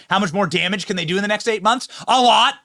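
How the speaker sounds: fast, high question, shouted answer; emphatic outburst. Real, not acted. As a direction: fire off the question, then belt the answer.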